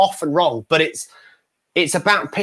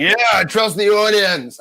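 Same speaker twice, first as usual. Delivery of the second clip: mock confident; 5 semitones higher than usual. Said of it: "Here's me just like".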